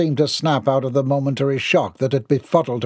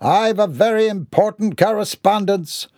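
pompous, higher-pitched Mortimer; declaring; 7 semitones higher than usual. A narrator's reading. Mortimer hands the postman an important letter, declaring. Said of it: "Mortimer declared".

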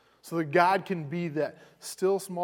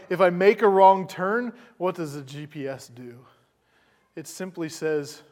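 ordinary talk

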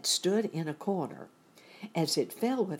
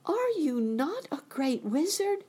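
put-on very high, louder girlish disbelief; incredulous question; 13 semitones higher, 5 dB louder than usual. A narrator's reading. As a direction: question it in shock, pitched way up. Dorothy shocked at the confession.

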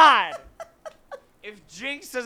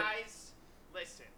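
distant, off-mic voice; from across the room; 19 dB quieter than usual. Real, not acted. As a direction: far from the microphone. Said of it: "you sound like you're across the room".

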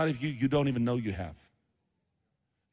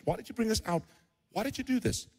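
high, softer plaintive questions; mock helpless protest, pitched up; whining complaint; 6 semitones higher, 7 dB quieter, faster than usual. Israelites asking Moses why he did this, whining.

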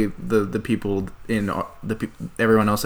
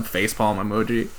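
normal talking voice

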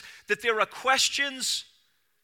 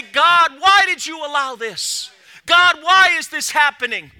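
loud, higher anguished plea; crying out to God; 6 semitones higher, 10 dB louder than usual. Pretend. Preacher voicing a sufferer crying out to God, loud plea. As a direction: call out to God in pain. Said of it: "cry out to God and say".

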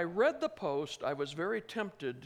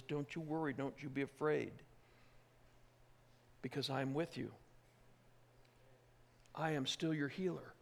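soft, hushed reassurance; lightly voiced tender comfort; voice of God comforting; 6 dB quieter than usual. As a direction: murmur it like a gentle promise. God's Spirit voiced comforting the listener.

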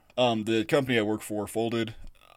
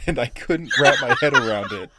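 laughing; 2 semitones higher, 8 dB louder than usual. Real, not acted. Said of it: "quoting old cold open laughing".

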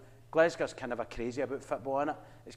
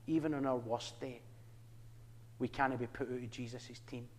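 soft sincere assurance; 4 semitones lower, 11 dB quieter than usual. Real, not acted. Sermon climax, soft.

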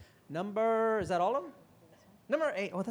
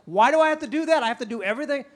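loud, higher whining protest; whiny complaint; 7 semitones higher, 9 dB louder, faster than usual. Retold or imitated. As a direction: whine like you're hard done by. Employee whining at boss.

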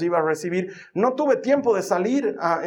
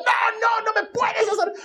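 put-on much higher shouted child protest; little girl wail. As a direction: wail like a toddler refusing. Daughter voiced protesting.

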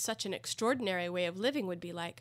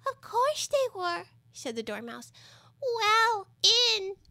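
Dormouse voice; 13 semitones higher, 6 dB louder, slower than usual. A narrator's reading.

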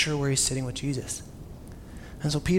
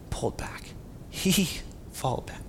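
slow whisper; 8 dB quieter, slower than usual. Real, not acted. Describing Peter pulling back.

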